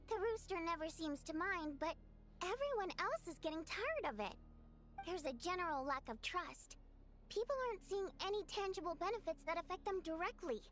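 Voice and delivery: ordinary delivery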